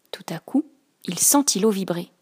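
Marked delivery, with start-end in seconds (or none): none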